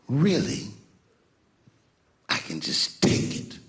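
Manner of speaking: acted menace, slowing right down; slow, heavy mock threat; intimidating older man. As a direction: loom over a kid, imitating a bully. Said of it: "would intimidate me or scare me".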